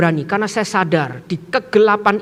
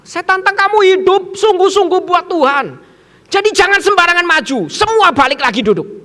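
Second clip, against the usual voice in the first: high, loud preaching to a crowd; forceful challenge; 9 semitones higher, 5 dB louder than usual. Retold or imitated. Preacher challenging pupils who came forward, forceful. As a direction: fire it out as a firm command.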